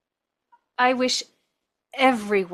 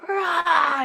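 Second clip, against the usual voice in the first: roar; 8 semitones higher, 6 dB louder than usual. Retold or imitated. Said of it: "I was just screaming".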